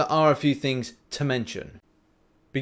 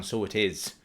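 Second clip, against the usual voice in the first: Irish accent; 4 semitones lower, 4 dB quieter than usual. Real, not acted. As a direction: slip briefly into an Irish lilt. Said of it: "why I went Irish then".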